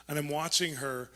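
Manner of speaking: ordinary talk, nothing marked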